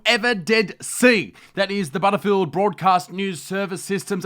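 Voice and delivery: loud, high, brash parody newsreader; host a trashy news show with swagger; mock news anchor.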